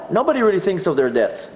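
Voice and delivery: normal talking voice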